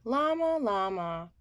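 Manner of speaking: ordinary delivery, nothing marked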